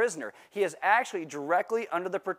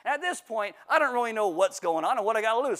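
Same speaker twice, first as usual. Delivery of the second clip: ordinary talk, nothing marked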